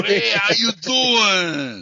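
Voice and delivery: slow, high Italian "how you doing"; Italian guy voice. Acted. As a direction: greet like a friendly Italian.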